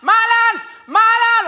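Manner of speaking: high, sharp yell of a name; same high call, over and over; mum calling out. Acted. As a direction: imitate a mother calling through the house. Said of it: "she calls out my name".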